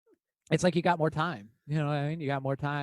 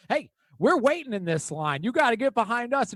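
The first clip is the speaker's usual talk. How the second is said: raised, higher scolding voice; retold confrontation; 6 semitones higher, 4 dB louder than usual. Lady jumping over the cart at a newcomer, imitated scolding. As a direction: imitate an indignant shopper, pitched up.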